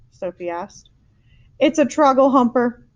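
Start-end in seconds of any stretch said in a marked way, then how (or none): 1.6-2.9 louder, furious anguish; shouted. A narrator's reading. Giant to the girl about the nightmare, shouting. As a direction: shout it in outrage. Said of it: "he shouted".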